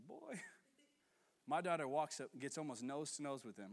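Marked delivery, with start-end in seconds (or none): 0.1-0.4 put-on warning boy. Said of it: "I was like".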